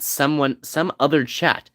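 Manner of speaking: ordinary talk, nothing marked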